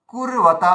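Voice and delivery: কুরবাতা with কুর stretched, then বাতা; long first syllable. Shown as a demonstration. Teacher shows the required way, কুরবাতা drawn out after the quick version. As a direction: hold the first vowel of কুরবাতা and break after র. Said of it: "কুটা দীর্ঘ উচ্চারণ হয়ে গেল".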